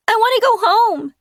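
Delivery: put-on a child calling out near tears; tearful child. A narrator's reading. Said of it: "I think he sounds tearful".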